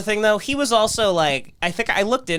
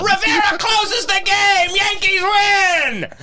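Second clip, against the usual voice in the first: sportscaster call; 13 semitones higher, much slower than usual. Pretend. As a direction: call it like a ballgame announcer. Mocking reporter as baseball call.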